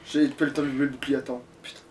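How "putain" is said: ordinary delivery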